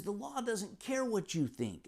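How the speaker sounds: high, slow mock reprimand; retold riot act. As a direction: imitate an officer laying down the law. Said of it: "read him the riot act".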